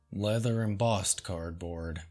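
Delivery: ordinary talk, nothing marked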